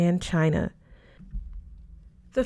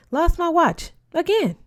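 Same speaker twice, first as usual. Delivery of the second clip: higher, louder, mock despair; exasperated teacher; 10 semitones higher, 4 dB louder than usual. A narrator's reading. Professor who lost his watch, exasperated and a touch louder. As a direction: groan it out like a scatterbrained professor.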